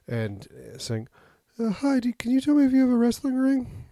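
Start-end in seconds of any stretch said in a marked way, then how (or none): 1.6-3.9 put-on high kid voice. Kid calling toy store, high voice.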